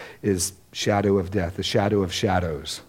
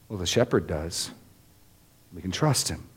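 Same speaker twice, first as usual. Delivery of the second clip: softer, lower, reassuring answer; quiet reassurance; 4 semitones lower, 6 dB quieter than usual. Real, not acted. Preacher answers the congregation's fears, quiet and reassuring. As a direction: ease the congregation's fear gently.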